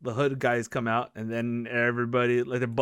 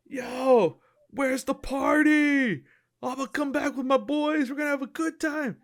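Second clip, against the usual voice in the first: rowdy weirdo; 12 semitones higher than usual. Retold or imitated. Weirdo coming down the street looking for the party, rowdy high holler. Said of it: "here comes some weirdo down the street".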